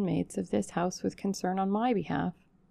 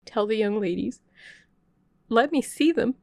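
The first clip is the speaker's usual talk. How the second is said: higher, louder begging; urgent plea; 4 semitones higher, 5 dB louder than usual. Acted. Stranger begging the servant for the ladies, loud plea. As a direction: plead to be let in. Pleading at the door.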